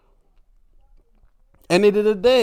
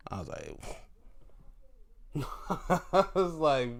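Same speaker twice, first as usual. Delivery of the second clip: laughing; 5 dB quieter than usual. Real, not acted.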